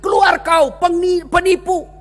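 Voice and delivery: put-on shouted jeer from a crowd of kids; children driving the Prophet out of Taif, shouting; mock shout. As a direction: yell them out of town. Children stoning, shouted insults.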